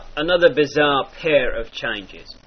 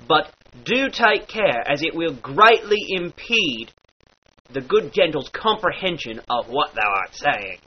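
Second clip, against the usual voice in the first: normal talking voice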